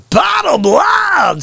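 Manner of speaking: drawn-out, high-pitched yell; shouted catchphrase. Pretend. Host breaking into his catchphrase, big drawn-out yell. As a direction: blast it out slowly, way up high.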